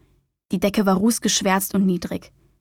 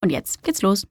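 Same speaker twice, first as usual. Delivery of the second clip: ordinary delivery, nothing marked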